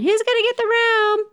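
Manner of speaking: put-on high sing-song kid chant; imitated kids. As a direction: mimic eager kids calling out, very high. Mimics kids outside calling out.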